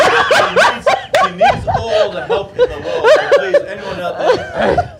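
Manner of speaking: laughing. Real, not acted. Host laughing at friend's plea.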